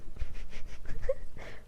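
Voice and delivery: loud, high burst of giggles; real giggle; laughing. Real, not acted. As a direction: burst out laughing at your own accent. Laughs at attempted English accent.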